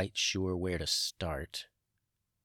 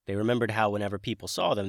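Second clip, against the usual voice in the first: ordinary delivery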